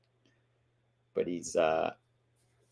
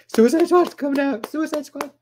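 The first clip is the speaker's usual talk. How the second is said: much higher, excited squeal of anticipation; hyped; 10 dB louder than usual. Retold or imitated. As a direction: blurt it out like a fan who just spotted the Easter egg. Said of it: "I was so hyped".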